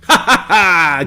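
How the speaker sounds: bright burst of laughter; real amused laugh. Real, not acted. Laughing at partner's teasing.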